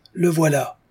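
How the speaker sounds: high, emphatic cry; denouncing. A narrator's reading. Raïssa singles out Morozza to the crowd, denouncing. Raïssa points out the true culprit.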